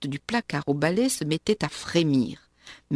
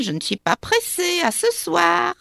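a sour little witch, mocking; shrill spiteful witch; 12 semitones higher, 3 dB louder than usual. A narrator's reading. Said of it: "dit-elle". (voix de sorcière pointue, aigre et méchante)

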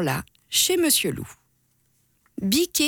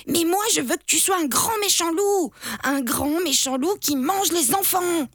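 put-on bratty kid voice; 8 semitones higher than usual. A narrator's reading. Stubborn kid demands the wolf.

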